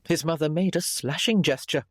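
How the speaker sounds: plain reading voice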